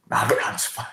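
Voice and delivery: breathy whisper, barely there; whispered aside. Real, not acted. Reassuring viewers she's fine, under her breath. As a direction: hush it as if to yourself.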